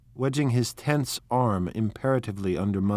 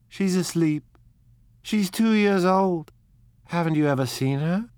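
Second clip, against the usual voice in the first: gushing; 8 semitones higher, 4 dB louder than usual. Acted. Daisy talking about her baby.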